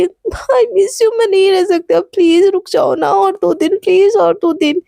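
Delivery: high, tearful voice, begging her to stay; tearful plea with a sob in it; crying, pleading. Acted. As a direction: plead through tears, voice catching. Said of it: "एकदम फूट फूट के रो रही होती है।".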